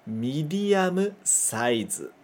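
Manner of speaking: slow "Medium size" as midiamu saizu; katakana medium size. Shown as a demonstration. Teacher modelling katakana "Medium size" slowly. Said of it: "Mais uma vez".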